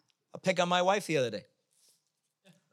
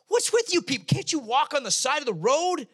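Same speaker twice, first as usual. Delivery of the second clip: high, loud exasperated rant; acted irritated outburst; 8 semitones higher, 4 dB louder than usual. Angry driver to people walking, high exasperated yell. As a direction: berate walkers in mock outrage. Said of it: "And you're like".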